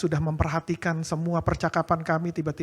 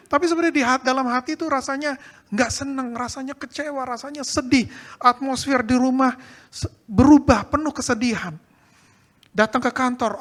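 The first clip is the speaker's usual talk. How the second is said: high and loud, rousing the congregation; full-voiced pulpit preaching with a wry edge; preaching at full force; 7 semitones higher, 8 dB louder than usual.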